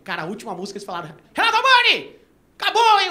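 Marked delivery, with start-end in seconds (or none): 1.3-3.1 high, loud call; stage shout. Retold or imitated. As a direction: announce it loud from the mic. Singers calling from stage. (chamado alto no palco)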